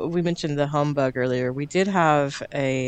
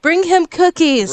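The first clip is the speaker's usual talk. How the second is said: "Bring him cookies." jumps much higher and louder; mock squealing advice; 12 semitones higher, 7 dB louder than usual. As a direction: shriek it like gushing dating advice. Joking tips to get a man.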